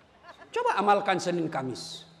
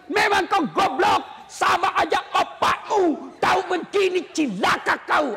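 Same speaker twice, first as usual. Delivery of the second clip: hammed-up wife shouting insults at husband, higher, slow; mock cursing wife; 7 semitones higher than usual. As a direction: yell the insults like a furious wife. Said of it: "yang tidak boleh maki-maki suami".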